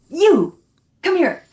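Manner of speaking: high, loud call across the set; mock director's call. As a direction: summon the grip sharply. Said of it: "and he goes".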